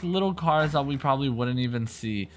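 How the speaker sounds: ordinary talk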